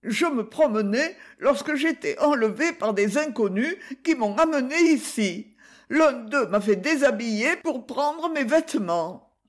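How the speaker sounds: high-pitched character voice, complaining; plaintive victim. A narrator's reading. Freed Poiroteau complains to the chevalier, plaintive.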